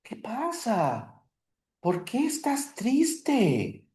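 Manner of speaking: high, slow and caring; concerned asking. Acted. Teacher playing a friend asking why she is sad, concerned. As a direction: gently ask what is wrong. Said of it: "What if I want to say".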